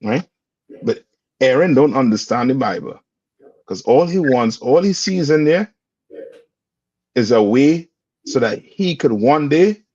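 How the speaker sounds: ordinary talk, nothing marked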